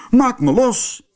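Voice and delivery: high-pitched demand to be freed; called out. A narrator's reading. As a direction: call out high, stuck and wanting loose. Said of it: "riep hij". (hoog uitgeroepen)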